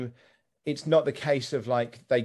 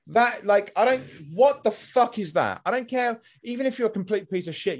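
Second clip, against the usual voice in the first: exasperated rant; 8 semitones higher than usual. Real, not acted. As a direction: spit out the disbelief. Ranting about streamer's response.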